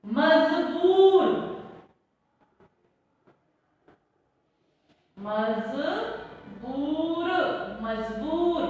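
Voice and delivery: "मजबूर" very slow, z in the middle; slow z demo. Shown as a demonstration. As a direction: stretch "मजबूर" as majboor with z. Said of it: "नुक्ता वाले शब्द देखो". ("मजबूर" धीरे-धीरे, बीच में ज़)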